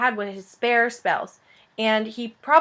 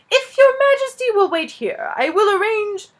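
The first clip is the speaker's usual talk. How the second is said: higher and louder, ever more self-important; pompous, building; 12 semitones higher, 7 dB louder than usual. Acted. Elf steward lecturing the king, growing pompous. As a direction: swell with pomp until cut off. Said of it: "more and more pompous with every word".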